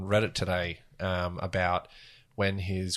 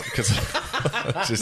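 laughing; 7 semitones higher, slower than usual. Real, not acted. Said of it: "I was gonna do an accent".

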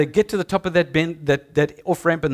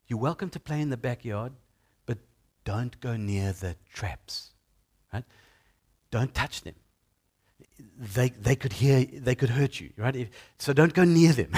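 put-on gentle warning to kids; 6 semitones lower, 4 dB quieter than usual. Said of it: "I'd probably say to those children".

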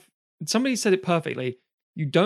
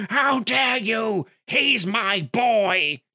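high, very slow mock-indignant fan; hammed-up outraged devotee, slow and high; offended fanboy; 7 semitones higher, much slower than usual. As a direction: drag it out like a wounded superfan. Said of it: "someone will say".

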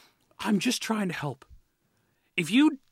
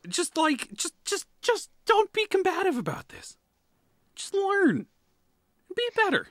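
exasperated plea; 10 semitones higher than usual. Real, not acted. Heated rant at Flyers owners.

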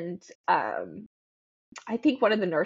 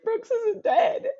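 broken half-laugh; 14 semitones higher, slower than usual. Real, not acted. Telling dad, breaking down.

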